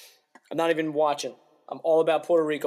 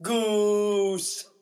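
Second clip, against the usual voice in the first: mock yell; 7 semitones higher, 4 dB louder than usual. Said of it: "she yells".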